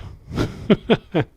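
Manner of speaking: a high, breathy burst of laughter; real laugh. Real, not acted. Laughing at himself after the Cumbria rant. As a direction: laugh at your own protest.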